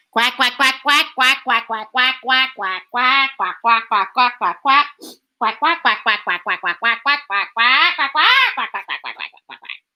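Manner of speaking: rapid run of quacks, fading at the end; duck quacking. Acted. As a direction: quack away like a whole flock. Imitates a flock of ducks.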